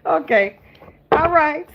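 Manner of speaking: ordinary talk, nothing marked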